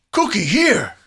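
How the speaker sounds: high excited "Cookie here!"; mock monster arrival. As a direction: announce the monster has shown up.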